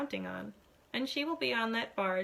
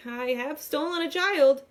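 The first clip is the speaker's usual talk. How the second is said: panic; 3 semitones higher, 7 dB louder than usual. A narrator's reading. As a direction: blurt it out in panic. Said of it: "I start to panic".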